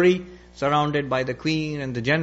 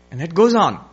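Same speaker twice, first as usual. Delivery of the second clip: ordinary delivery, nothing marked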